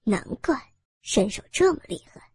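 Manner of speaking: sneering gang boss with a chuckle; cold sneer. Acted. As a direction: gloat mockingly over the unmasked officer. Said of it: "头目冷笑着说道". (头目冷笑嘲讽)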